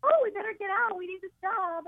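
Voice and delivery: high, urgent little voice; voicing her breasts. Comedian voicing her breasts, high and urgent. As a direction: make the breasts panic about their job. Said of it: "the girls are like".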